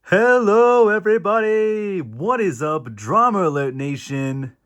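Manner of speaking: hyped host intro. Pretend. Opening the show to his viewers, hyped host parody. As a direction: belt it out like an over-the-top drama channel host.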